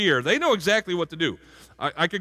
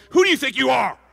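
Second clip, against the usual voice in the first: higher, louder mock mom yell; 8 semitones higher, 6 dB louder than usual. Preacher voices an angry mom yelling at her kid, mock yell. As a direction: bark it like a fed-up mother. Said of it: "that mom who every time you got in trouble".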